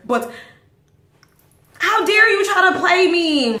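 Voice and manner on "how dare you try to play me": high, loud, affronted challenge; indignant outburst. Retold or imitated. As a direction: call them out, affronted and loud. Indignant at being cheated.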